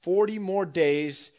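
slow, loud prophet's call through a bullhorn; bullhorn proclamation. Retold or imitated. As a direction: announce it slowly to the whole town. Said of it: "proclaiming with the bullhorn".

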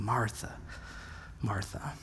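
soft, low, tender calling of the name; Jesus gently calling Martha, hushed and tender. Retold or imitated. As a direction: breathe out and say it with a smile. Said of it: "very gently saying".

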